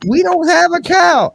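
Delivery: very high, slow put-on voice; high, slow mock old lady denying the cow; mock sleepy wife. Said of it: "she goes".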